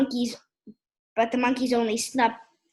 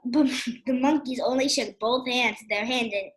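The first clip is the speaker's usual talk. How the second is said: usual speaking voice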